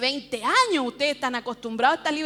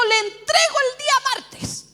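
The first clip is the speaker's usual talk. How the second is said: high, raised-voice pronouncement; emphatic announcement; 9 semitones higher, 4 dB louder than usual. Retold or imitated. Father handing her Tuesdays, emphatic announcement. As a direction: declare it like a decree.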